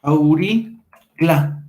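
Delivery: "auricla" in two long, separated pieces; very slow, deliberate teacher's demonstration; sounded out slowly. Shown as a demonstration. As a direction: stretch out "auricla" syllable by syllable. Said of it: "va a desaparecer y nos queda".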